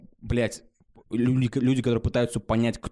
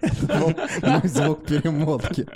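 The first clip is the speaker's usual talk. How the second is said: laughing; 3 semitones higher than usual. Real, not acted. Explains his rewind noise, laughing.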